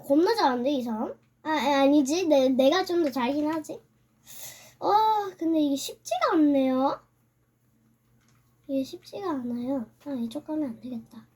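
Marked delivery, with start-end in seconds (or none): none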